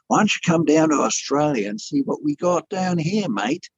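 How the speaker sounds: put-on a friend's Australian accent with a laugh at the end; a light Australian accent ending in a chuckle. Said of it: "I'm trying to do an Australian accent".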